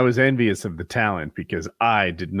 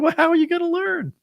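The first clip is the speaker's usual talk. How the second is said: mock nagging.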